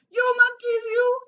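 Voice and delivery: loud, high scolding peddler; angry scolding. A narrator's reading. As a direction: shake a finger and scold the monkeys. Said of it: "he said, shaking his finger at them".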